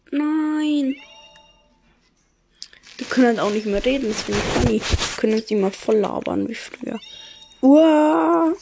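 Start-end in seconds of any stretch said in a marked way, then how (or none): 0.1-1.0 high, drawn dismayed no; a shocked groan of a no. Real, not acted. Player reacting to faces being stolen, shocked. 7.6-8.5 high, loud disgusted yelp; horrified cry. Real, not acted. Player seeing the faceless villagers, horrified yelp. Reacting in horror.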